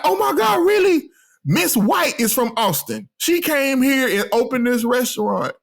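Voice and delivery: high-pitched gushing imitation; excited amazement. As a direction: imitate a waitress thrilled by a shared hometown. Acting out waitress's excited reaction.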